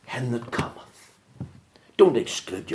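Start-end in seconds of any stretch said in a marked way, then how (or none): 0.1-1.1 soft emphasis. Shown as a demonstration. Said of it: "Underline the word".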